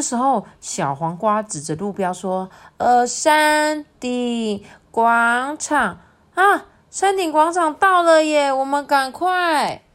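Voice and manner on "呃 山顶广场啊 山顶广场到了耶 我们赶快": high, loud, stretched-out cheer; excited discovery. Acted. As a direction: read out the sign slowly, then cheer the others on. Spots the summit sign, cheers.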